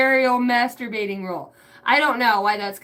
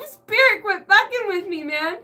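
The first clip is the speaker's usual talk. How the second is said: laughing; 7 semitones higher than usual. Real, not acted. Laughing plea to spirit.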